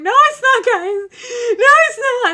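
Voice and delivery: high, loud wailing protest; hammed-up tearful no; mock crying protest. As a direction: wail it like a kid refusing. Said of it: "screaming and crying the whole way".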